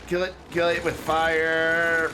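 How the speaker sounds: slow, loud hammed-up disgust; mock horror, drag it out; mock dramatic. Jokey disgust at misaligned road.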